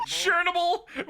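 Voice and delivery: laughing. Real, not acted. Laughing disbelief at the word.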